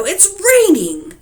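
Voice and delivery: high, slow, loud complaint; angry shout. Acted. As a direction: shout it out at the window. Farmer rages at the rain.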